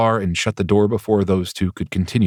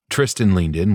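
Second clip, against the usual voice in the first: plain reading voice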